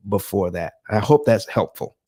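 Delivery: normal talking voice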